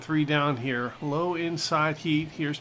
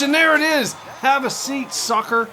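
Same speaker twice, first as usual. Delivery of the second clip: much higher, louder gloating call; gleeful strikeout taunt; 9 semitones higher, 7 dB louder than usual. Real, not acted. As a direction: crow over the beaten batter.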